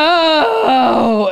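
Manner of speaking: high far-off cry of no; coworkers' cry of dismay. Retold or imitated. Coworkers groaning no across the warehouse. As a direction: wail it like the song just ruined everything. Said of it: "my coworkers go".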